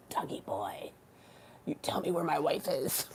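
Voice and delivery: hushed, put-on threatening voice; mock interrogation. Sketch character demanding his wife's whereabouts, hushed threat. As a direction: softly menace him in character.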